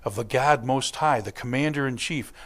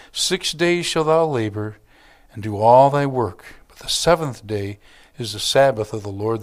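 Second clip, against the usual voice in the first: solemn, louder recitation of the commandment; scripture reading; 3 semitones lower, 5 dB louder than usual. Acted. Pastor reading the Sabbath commandment, solemn. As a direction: proclaim it as sacred law.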